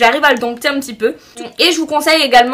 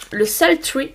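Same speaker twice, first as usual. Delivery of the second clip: shade name Sultry said the English way, softer; English accent; 5 dB quieter, much slower than usual. Real, not acted. Vlogger reads out her lipstick shade in an English accent. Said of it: "Oh, quel accent anglais".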